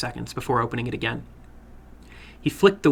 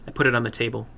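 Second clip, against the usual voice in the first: ordinary delivery, nothing marked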